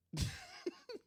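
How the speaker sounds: squeaky burst of giggling; high-pitched real giggle. Real, not acted. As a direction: crack up high. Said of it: "I don't know why I'm doing that".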